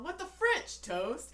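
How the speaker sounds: hammed-up indignant cry; mock outrage. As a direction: play the husband's shocked outburst. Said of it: "he's all".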